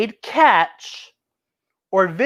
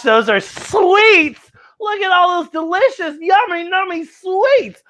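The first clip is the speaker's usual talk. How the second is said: higher, drawn-out delighted voice; 9 semitones higher than usual. Pretend. Storyteller delighting kids over a bucket of sweets. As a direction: gush over the yummy sweets.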